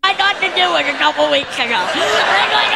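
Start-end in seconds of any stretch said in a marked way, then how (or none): none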